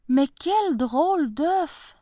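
high, slow astonishment; puzzled. Acted. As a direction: marvel at the strange egg. Farmer puzzled by the eggs.

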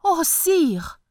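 high, loud exclamation; cry out. Acted. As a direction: burst out in awe. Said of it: "s'écria le fermier".

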